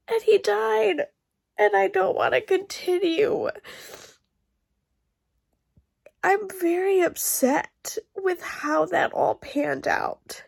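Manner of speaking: upset reader after the death scene, tearful and high; crying. Real, not acted. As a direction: fight the tears and keep talking. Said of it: "me crying".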